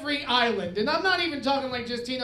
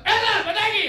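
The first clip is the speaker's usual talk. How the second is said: loud, high bellow, louder still; dad yelling the name again, louder; 9 semitones higher, 7 dB louder than usual. Retold or imitated. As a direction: bellow the name even harder. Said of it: "they start yelling it even louder".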